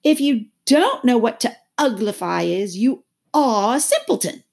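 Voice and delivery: high, loud, emphatic; loud creature retort; scornful Gryphon. A narrator's reading. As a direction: press the point loudly. Gryphon scolds Alice.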